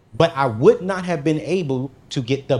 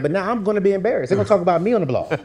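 fast, high mock worry; mock panic, fast; 6 semitones higher, much faster than usual. Telling the audience his fear of being embarrassed, rushed and high. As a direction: rattle it off in fake panic. Retold worried thoughts.

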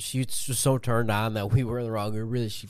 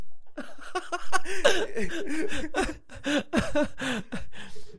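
long squealing laugh; high-pitched laugh. Real, not acted. Laughing at the joke.

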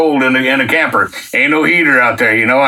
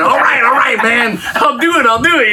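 excited giving in; 7 semitones higher than usual. Retold or imitated. Finally giving in to God's push, high and eager. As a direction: throw up your hands and shout yes.

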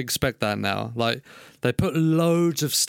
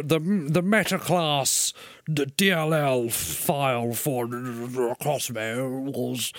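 slow, fading nature-documentary voice; slower than usual. Real, not acted. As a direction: drift off like a tipsy narrator. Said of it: "Why do you sound like a drunk David Attenborough?".

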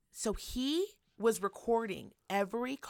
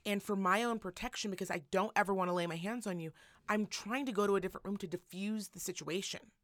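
softer, calmly pleading husband; imitating calm; 3 semitones lower, 7 dB quieter than usual. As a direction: quietly talk her down. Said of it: "you can hear him very calmly".

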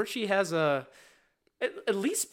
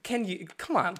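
ordinary talk

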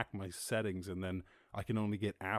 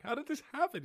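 much higher, incredulous question; disbelief. Retold or imitated. Viewer stunned at the prime minister, high and incredulous. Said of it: "You're like".

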